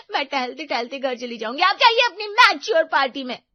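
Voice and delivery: high, rising, loud sulk; offended girlfriend snapping back; huffy refusal. Acted. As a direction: storm off with a sarcastic jab. Angry girlfriend refuses the lift.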